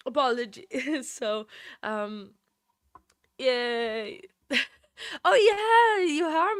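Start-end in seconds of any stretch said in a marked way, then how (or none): 5.2-6.6 much higher and louder burst of joy; thrilled yelp; excited. Real, not acted. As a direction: squeal it out with delight. Delighted at a donation.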